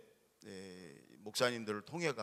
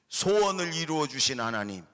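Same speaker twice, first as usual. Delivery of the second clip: louder call and response with 소원을 stressed; loud call again; 2 semitones higher, 7 dB louder than usual. Shown as a demonstration. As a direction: proclaim it loud for the children to repeat. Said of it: "다시 한번".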